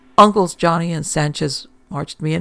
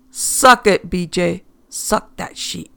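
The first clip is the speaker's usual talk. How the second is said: menacing order; 3 semitones higher, 4 dB louder, slower than usual. A narrator's reading. Gangster with gun ordering.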